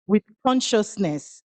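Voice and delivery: ordinary talk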